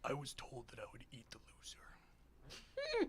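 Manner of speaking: slow, breathy monster whisper; creepy whisper. Acted. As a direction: hiss it slowly like a hungry demon. GM voices creature, whispers.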